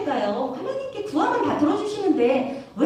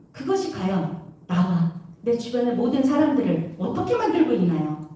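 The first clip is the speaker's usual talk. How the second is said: usual speaking voice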